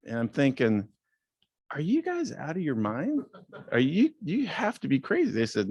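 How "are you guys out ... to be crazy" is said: higher, pitched-up retold disbelief; lightly mock astonished inner voice; incredulous disbelief. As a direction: play the shock of hearing the crazy offer. Thinking the offer is crazy.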